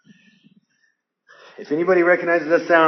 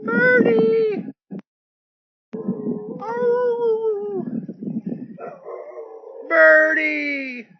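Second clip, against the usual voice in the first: calling the dog; much slower than usual. Real, not acted. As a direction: call the dog home from far off.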